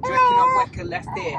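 put-on Southern accent. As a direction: ask it like a chirpy Southerner. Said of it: "Dad's speaking Southern".

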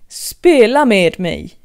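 loud, slow royal command. Acted. Queen of Hearts ordering Alice, slow and loud. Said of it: "befallde drottningen".

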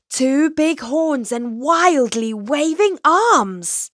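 dramatic, forceful scare; alarmed narration. A narrator's reading. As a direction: build up the monster's size with mounting drama. Monster sighting narrated.

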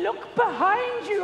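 high, slow panto audience cry; pantomime call-out. Shown as a demonstration. Trainer teaching the audience their line, pantomime call-out. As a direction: call it out slowly like a pantomime crowd. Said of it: "Have you not been to pantomime?".

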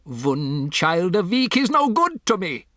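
put-on high, accented outburst, climbing in pitch; imperious witch complaint with v for w; witch crying out. A narrator's reading. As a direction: build to an indignant cry. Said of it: "the Grand High Witch cried out".

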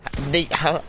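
sharp high exasperated yelp; frustrated outburst. Real, not acted. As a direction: blurt it out in disbelief. Gamer outburst at broken builds.

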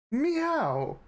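a squeaky, high-pitched meow; high cat meow. Pretend. Taunting the boss with a high cat meow.

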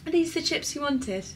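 mock the waiter in a thick Welsh accent. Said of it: "in, like, this thick Welsh accent".

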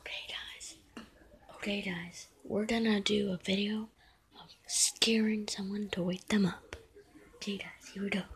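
hushed unvoiced whisper. Real, not acted. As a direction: whisper so nobody wakes. Whispering prank setup.

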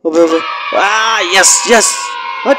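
high shout into a cheer; triumphant shout. Acted. Crossing the finish line first, high triumphant cheer. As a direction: cheer the win.

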